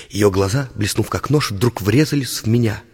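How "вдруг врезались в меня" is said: ordinary delivery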